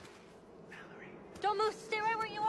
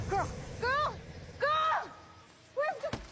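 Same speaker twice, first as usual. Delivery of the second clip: screaming a name; 7 semitones higher, slower than usual. Acted. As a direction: shriek for the missing child. Mother screams for missing girl.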